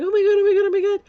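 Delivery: high, loud panicked cry, reliving it; freaking out. Retold or imitated. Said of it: "I'm freaking out".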